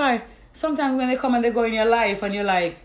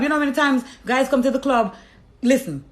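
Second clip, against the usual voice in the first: normal talking voice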